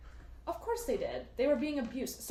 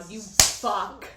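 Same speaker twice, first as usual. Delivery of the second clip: ordinary talk, nothing marked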